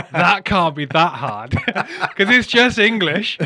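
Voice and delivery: slow words broken by laughter; laughing. Retold or imitated. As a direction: laugh while mocking the idea. Laughing through mock quote.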